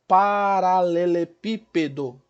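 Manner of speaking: "paralelepípedo" devagar, sílabas bem articuladas; separando sílabas. Shown as a demonstration. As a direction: estique um pouco cada sílaba de "paralelepípedo". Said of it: "quantas sílabas eu tenho?". ("paralelepípedo" silabado)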